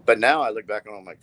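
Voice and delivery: usual speaking voice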